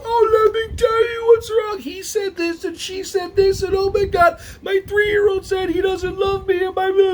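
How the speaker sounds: hammed-up moaning complainer; whiny complainer. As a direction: pour out your woes in a squeaky wail. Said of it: "and then you fall right".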